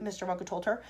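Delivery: ordinary delivery, nothing marked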